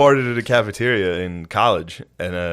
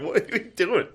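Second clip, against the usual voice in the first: high, softer squeal of protest; mock alarm; 11 semitones higher, 5 dB quieter than usual. Protesting to the swab lady.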